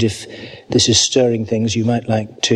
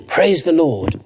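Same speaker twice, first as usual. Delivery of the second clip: rising proclamation; 4 semitones higher than usual. Real, not acted. A preacher closing the psalm, rising in praise. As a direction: proclaim it out, lifting to the end.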